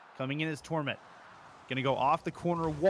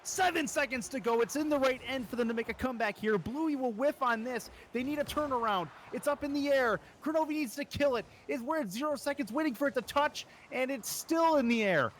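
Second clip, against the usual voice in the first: tense hype; 7 semitones higher than usual. Real, not acted. Final seconds play-by-play.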